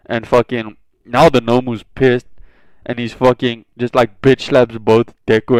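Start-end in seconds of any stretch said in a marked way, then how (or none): none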